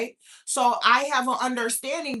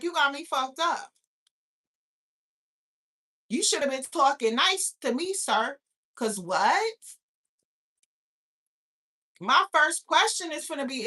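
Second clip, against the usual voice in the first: ordinary talk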